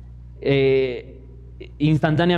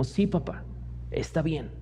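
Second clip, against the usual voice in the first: much softer, meek little answer; soft child's reply; 10 dB quieter than usual. Retold or imitated. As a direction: give a gentle, obedient small daughter's yes. Voicing his small daughter's reply.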